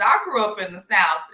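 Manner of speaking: ordinary delivery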